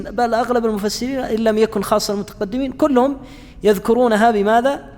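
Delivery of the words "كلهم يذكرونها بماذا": normal talking voice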